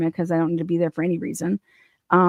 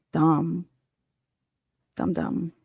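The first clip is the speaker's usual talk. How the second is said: usual speaking voice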